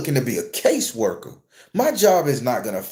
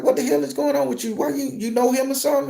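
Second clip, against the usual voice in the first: surprised coworker; 11 semitones higher, faster than usual. Retold or imitated. Coworkers ask the manager.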